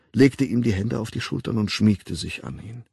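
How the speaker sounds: ordinary delivery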